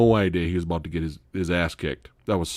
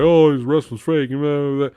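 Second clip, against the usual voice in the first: sneering; 6 semitones higher, 5 dB louder than usual. Retold or imitated. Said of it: "the guy was like".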